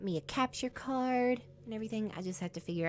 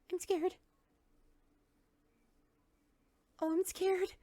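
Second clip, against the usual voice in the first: scared; 11 semitones higher than usual. Real, not acted. Game jumpscare builds.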